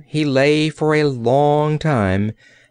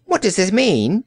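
high-pitched demand; 8 semitones higher than usual. A narrator's reading. Old courtier challenging the princess, demanding. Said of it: "he demanded".

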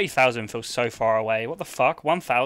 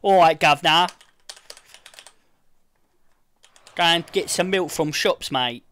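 mock British accent; 4 semitones higher, 5 dB louder than usual. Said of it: "I saw someone doing it yesterday".

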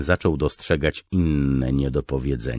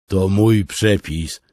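loud, slow proud cook; proud claim; 8 semitones higher, 10 dB louder, slower than usual. Acted. Beard claiming the recipe, slow proud loud boast. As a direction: puff up over your recipe. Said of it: "dodał Broda".

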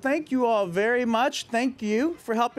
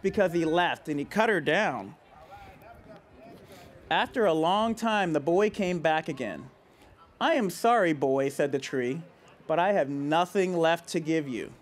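put-on reading to children; 5 semitones lower than usual. A narrator's reading. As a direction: let the sad ending settle gently. Reads picture book to kids.

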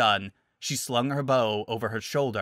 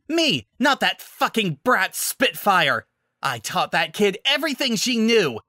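furious ranting; 10 semitones higher, 4 dB louder than usual. Acted. Villain ranting in fury.

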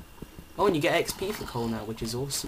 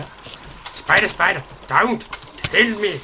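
higher, louder panicked pleading; mock panic; 6 semitones higher, 10 dB louder than usual. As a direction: beg then threaten in a fluster. Pleading then threatening the spider.

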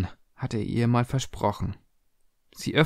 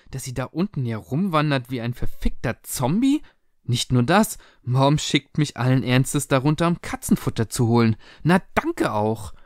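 put-on indignant teenage tirade, pitched up; worked-up daughter ending in sarcasm; outraged daughter; 4 semitones higher than usual. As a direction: reproach your parents, point after point. Daughter angrily reproaches parents.

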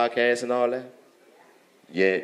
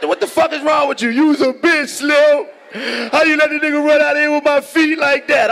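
much higher, louder accusing shout; high outraged mock yell; 4 dB louder than usual. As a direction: play the high man yelling that his feet were stolen. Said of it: "I'm like".

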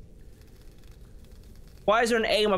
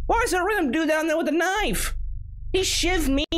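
high-pitched indignant disbelief; shocked outcry; 12 semitones higher than usual. Real, not acted. Player just stabbed in game, crying out in shock. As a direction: cry out, aghast and high. Just killed by knife attacker.

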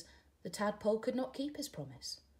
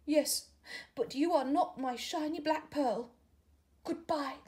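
higher and louder, final goodbye; sad farewell; 9 semitones higher, 5 dB louder than usual. Acted. Caterpillar to tadpole, saying goodbye, higher and louder. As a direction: let him go for good.

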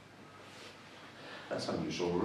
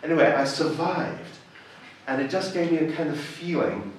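usual speaking voice

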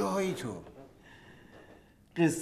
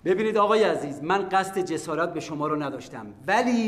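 louder, higher insistent appeal; loud pleading; 5 semitones higher, 6 dB louder than usual. Acted. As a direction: plead to be heard out. Pleading against being thrown out. (التماس بلند و پافشارانه)

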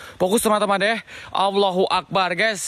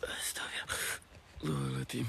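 slow, hushed whisper; whispered prayer; 4 semitones lower, 18 dB quieter, much slower than usual. Real, not acted. Ghost hunter praying to himself in the dark, slowly whispered.

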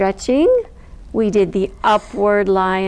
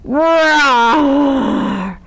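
loud, drawn-out playful roar; ferocious roar; 5 semitones higher, 4 dB louder than usual. Acted. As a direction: let out your fiercest lion roar. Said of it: "I let out a ferocious roar".

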